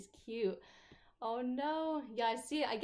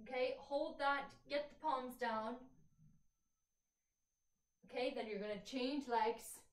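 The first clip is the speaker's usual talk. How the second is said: calm cueing of stretches, softer; fitness instructor; 3 semitones higher, 4 dB quieter than usual. Real, not acted. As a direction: talk the class through each stretch. Leads a stretching class.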